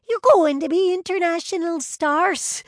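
very high, loud gushing; excited squeal. A narrator's reading. Brianna telling twins they'll be stars, squealing. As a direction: keep squealing, high and thrilled. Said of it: "she squealed".

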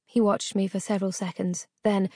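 ordinary delivery, nothing marked

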